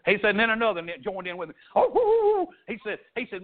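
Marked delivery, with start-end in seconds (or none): 1.8-2.5 high, loud imitated hound's bay; dog baying. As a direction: howl it like a coon dog on the scent. Dogs joining the hunt.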